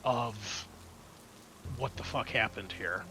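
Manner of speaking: ordinary delivery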